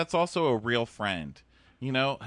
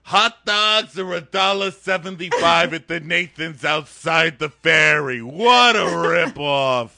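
loud, high, slow grumble; hammed-up mom gripe; mock complaining mom; 7 semitones higher, 10 dB louder, slower than usual. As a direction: whine about the price like an aunt. Voicing the mom complaining about prices.

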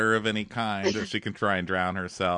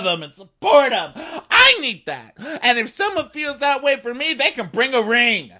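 high, loud shouted tirade; mock furious rant; 13 semitones higher, 8 dB louder than usual. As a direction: explode with fed-up defiance. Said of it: "that was finally a feminist rant".

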